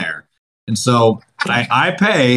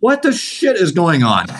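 mock exasperation; 6 semitones higher than usual. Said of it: "folding my arms".

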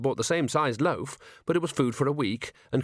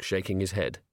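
ordinary delivery

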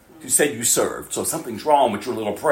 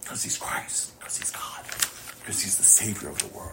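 hushed whisper; 2 semitones lower, 12 dB quieter than usual. Real, not acted. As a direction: whisper it, full of awe. Awed reverence for Christ.

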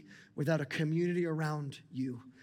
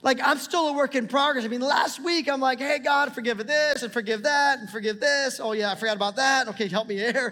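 much higher and louder, near shouting; full-force preaching; 8 semitones higher, 9 dB louder than usual. Acted. Pastor telling the church God is still changing him, loud fervent preaching. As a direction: let the sermon climax loudly.

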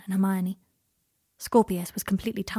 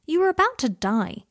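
exasperated; 8 semitones higher, 5 dB louder than usual. A narrator's reading. Harry exasperated.

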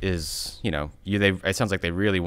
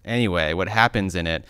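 normal talking voice

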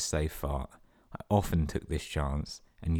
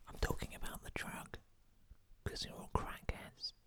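whispered aside; 15 dB quieter than usual. Real, not acted. Cheeky whispered aside to listeners.

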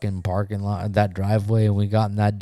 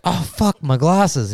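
higher-pitched nerd's lament; mock dismay; 6 semitones higher, 3 dB louder than usual. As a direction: imitate a brother upset over his glasses. Brother's dismay over glasses acted out.

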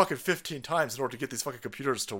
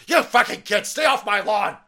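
put-on grumpy old man; 7 semitones higher, 12 dB louder than usual.

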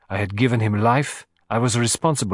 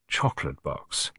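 softer, lower and gentle; murmured gently; 8 semitones lower, 8 dB quieter than usual. Acted. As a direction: murmur it gently as a teasing reminder. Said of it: "I murmured gently".

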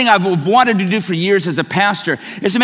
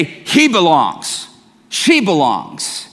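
slow; 4 semitones lower, much slower than usual. Retold or imitated. Slow quoted verdict.

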